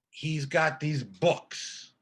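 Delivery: normal talking voice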